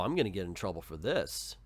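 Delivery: ordinary delivery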